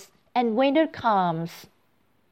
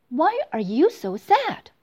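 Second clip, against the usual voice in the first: fairy voice; 7 semitones higher than usual. Acted. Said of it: "A fairy comes to her and asks".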